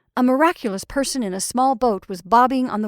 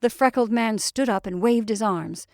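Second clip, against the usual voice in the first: plain reading voice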